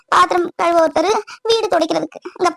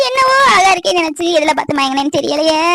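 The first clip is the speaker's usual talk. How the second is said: normal talking voice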